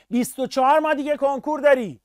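ordinary talk, nothing marked

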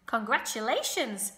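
loud, cheering call; shouted. A narrator's reading. Wilbur congratulating the goose on her goslings, shouted. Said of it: "shouted Wilbur".

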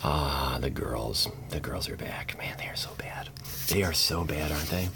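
hushed whisper about the bad cards. Real, not acted. Pack opener whispering to viewers about bad cards. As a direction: whisper it like a secret.

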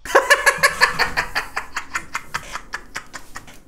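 breathless silent laugh, high and airy; long wheezing laugh. Real, not acted. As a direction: lose it completely at the clip. Streamer cracks up at clip.

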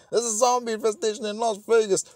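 high, quick mock alarm; hammed-up urgent official. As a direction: report it in a comic panic. Acts out panicking movie government.

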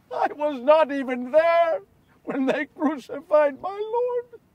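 high, strained lament, dropping in volume; grief-stricken confession. Acted. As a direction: let the shame choke the outburst down. Grieves his absence at crucifixion.

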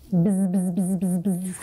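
low buzzing bee sound. Shown as a demonstration. Teacher showing kids a low bee buzz. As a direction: buzz like a bee trapped in a mouth. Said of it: "these little words here are going".

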